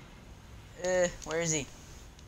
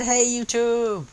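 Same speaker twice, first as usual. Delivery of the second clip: reading friend's message; 8 semitones higher, 8 dB louder, slower than usual. Retold or imitated. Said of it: "he said".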